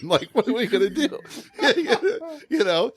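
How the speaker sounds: high, laughing question; laughing while talking. Real, not acted. Teller recalling the FAA worry, laughing. Laughing about peeing on airplane.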